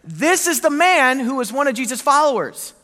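put-on a girl calling Peter out; accusing servant girl. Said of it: "Finally, she said".

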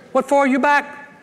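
high Cajun wife's voice, puzzled; Cajun accent. Acted. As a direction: put on a Cajun accent for the wife. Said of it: "you don't do a good Cajun accent".

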